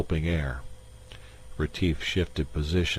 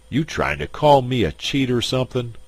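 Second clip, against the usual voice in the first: angry challenge; 7 semitones higher, 6 dB louder than usual. Acted. Shill confronts Retief.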